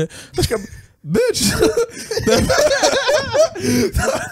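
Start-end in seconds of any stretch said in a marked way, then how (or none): 1.6-3.9 high laugh. Real, not acted. He laughs at her asking him for a ride, high burst of laughter.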